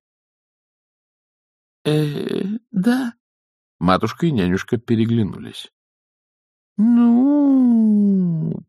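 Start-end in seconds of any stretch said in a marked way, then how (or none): 1.9-3.1 lightly timid, high yes; hesitate, then confirm in a small high voice; hesitant admission. Acted. Young witch answers her elder, hesitantly. 6.8-8.6 long, high drawn-out vowel. A narrator's reading. Nanny reacts after exchanging looks, drawling high. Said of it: "протянула нянюшка".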